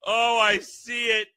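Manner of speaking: laughing hard while talking; can't stop laughing. Real, not acted. Laughing at a funny picture.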